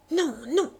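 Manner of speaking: cri aigu et fort de refus; cri désespéré. Acted. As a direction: crier non pour protéger les bijoux. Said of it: "cria-t-elle". (la comtesse crie son refus à Bush, paniquée)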